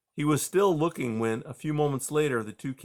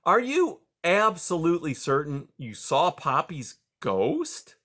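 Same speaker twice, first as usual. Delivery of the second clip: ordinary delivery, nothing marked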